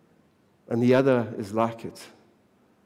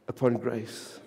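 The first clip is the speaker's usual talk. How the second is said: ordinary talk, nothing marked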